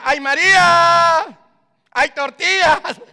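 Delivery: high, slow, loud shouted call across a Guatemalan shop. Retold or imitated. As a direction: yell for the shopkeeper. Customer calling out in a shop.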